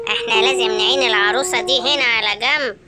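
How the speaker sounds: low-pitched plotting villain; scheming hyena. Acted. Villain telling his partner where to hide the doll, scheming. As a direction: lay out the plan in a low villain voice.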